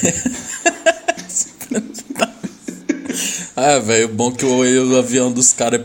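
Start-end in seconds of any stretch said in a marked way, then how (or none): 0.5-3.5 high breathy laugh. Real, not acted. Bursts out laughing.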